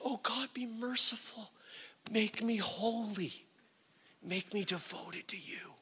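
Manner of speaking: soft, high-pitched plea to God; humble prayer. Acted. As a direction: plead softly and humbly, voice lifted. Voices a humble prayer of confession.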